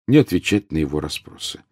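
usual speaking voice

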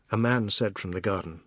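ordinary delivery

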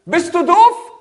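loud, high mock yell; angry insult. Young man in the woods yells at the echo, mock angry. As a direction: snap it at the voice.

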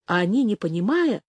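usual speaking voice

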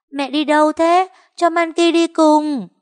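put-on high, loud child's plea, slowing down; pleading little girl. A narrator's reading. Monkey begs her mother to take her along, high and pleading. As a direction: beg like a small child not to be left.

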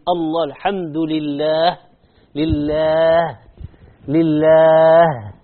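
لله drawn out slowly, ending on a long breathy هاء; slow لله, the final هاء held; stretched final h. Shown as a demonstration. Teacher demonstrating the هاء in لله, slow. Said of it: "ها، هذه الهاء".

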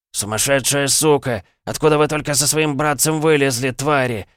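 high, loud spitting fury; spluttering rage. A narrator's reading. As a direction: spit the words out in rage. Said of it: "брызгал слюной Николай".

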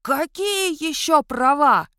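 медленный громкий крик, выше обычного; ори вслед убегающему; орёт вслед. A narrator's reading. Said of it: "орал ему вслед Ионуц". (орёт вслед беглецу, громко и медленно)

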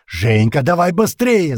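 strained shout. A narrator's reading. Captain urges Zhenka as the door breaks, strained shout. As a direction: shout to hurry him, voice squeezed. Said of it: "сдавленно выкрикнул капитан".

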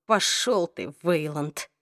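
soft, husky curse with low pitch. A narrator's reading. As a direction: rasp it out, too weak to attack. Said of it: "произнести сиплым голосом".

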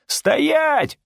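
high-pitched shouted halt; strained high shout at moderate volume; yelled order. A narrator's reading. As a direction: yell it high to stop a runaway. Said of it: "заорал тот".

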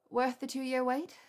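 lightly voiced drowsy woman; blurry voice. A narrator's reading. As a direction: draw it out, a little hazy. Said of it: "she asked in a blurry voice".

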